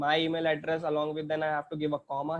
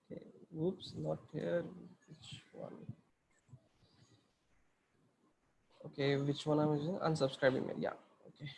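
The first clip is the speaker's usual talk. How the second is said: much softer, half-whispered to himself while searching the screen; muttered aside; 10 dB quieter than usual. Real, not acted. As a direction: drop to a private mutter, almost whispering.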